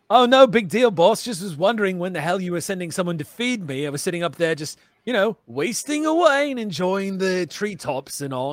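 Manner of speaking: Vecker. Acted. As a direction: open high and loud, then ease off while grumbling. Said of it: "Vecker shrugs and goes".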